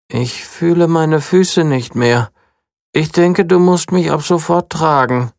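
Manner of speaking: sulky princess demanding to be carried; grumbling princess. A narrator's reading. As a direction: grumble that someone must carry you. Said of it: "mault Rosalie".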